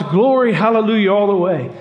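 high, loud hallelujah; joyful shout. Acted. Ed alone by his hearth, shouting joyful hallelujah. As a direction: cry out glory in triumph. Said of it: "And Ed shouts".

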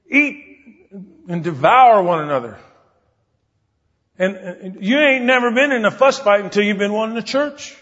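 high, loud preaching, slowing on key lines; full-force sermon delivery; fervent preaching. Acted. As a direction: drive the point home from the pulpit.

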